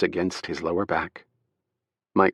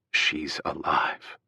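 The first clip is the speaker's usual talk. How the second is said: slow, soft, awed disbelief; stunned relief; 5 semitones lower, 6 dB quieter, much slower than usual. A narrator's reading. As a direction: let it sink in slowly.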